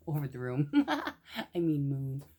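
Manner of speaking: laughing at own slip. Real, not acted. Reader laughs at her misread word, laughing. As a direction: giggle through the correction.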